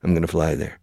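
"gonna" said as one quick word; natural reduced form. Shown as a demonstration. Teacher shows what natives always say, "gonna" reduced. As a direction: slur going to into "gonna". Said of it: "We always say".

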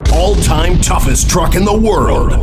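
mock truck ad hype. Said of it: "when you hear claims like".

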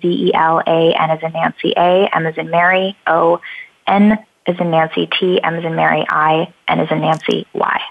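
letter by letter, with name examples; spelling it out. Shown as a demonstration. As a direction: spell each letter clearly. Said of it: "it's zelana momeni dot com".

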